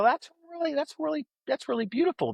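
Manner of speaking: bright high fawning admiration; gushing compliment. Retold or imitated. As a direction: gush over her necklace way up high. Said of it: "And I go".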